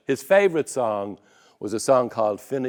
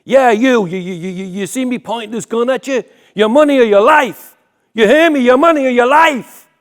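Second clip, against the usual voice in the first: loud, high stick-up yell; robber's shout; 10 semitones higher, 11 dB louder than usual. Acted. Gunman shouting at his victim, a loud high threatening yell. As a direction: bark it like a robber holding a gun.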